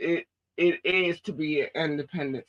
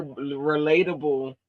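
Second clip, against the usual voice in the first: ordinary talk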